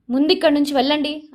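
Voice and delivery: loud, high order to get out; retold shout. Wife shouting at her husband to leave, loud. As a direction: yell at him to leave the room. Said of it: "అని అరుస్తుంది".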